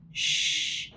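breathy whispered shh; whispered shush. A narrator's reading. Brother whispers a shush at the puppy. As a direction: hush it without voice. Hushing the puppy once more.

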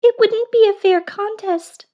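very high squeaky mouse; tiny mouse voice. Acted. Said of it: "said the mouse".

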